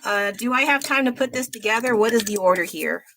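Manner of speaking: ordinary talk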